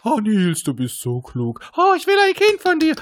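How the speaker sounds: high, loud swooning falsetto; swooning fan voice. Pretend. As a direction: gush over him in falsetto. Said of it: "Nur mit verstellten Stimmen".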